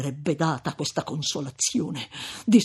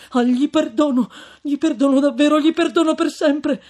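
much higher and louder, desperate; fervent exclamation; 10 semitones higher, 9 dB louder than usual. Acted. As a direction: cry out your promise. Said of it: "esclamò il giovine". (esclamazione accorata e acuta)

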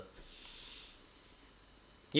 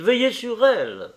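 louder, higher urgent cry; cried out; 10 semitones higher, 5 dB louder than usual. Acted. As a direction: cry it out, begging. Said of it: "s'écria-t-il". (s'écria-t-il, plus fort et plus aigu)

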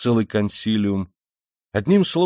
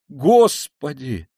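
loud, drawn-out, raised cry; frightened cry; 5 semitones higher, 6 dB louder, much slower than usual. A narrator's reading. Mother as her son says farewell, horrified cry. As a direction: wail it slowly in fright. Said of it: "вскрикнула Пульхерия Александровна".

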